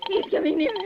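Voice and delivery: normal talking voice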